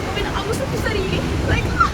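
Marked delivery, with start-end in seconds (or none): 1.5-1.9 sudden high yelp; short startled shriek; mock scream. Mock shout imitating onlookers.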